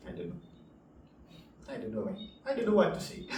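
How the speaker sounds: ordinary talk, nothing marked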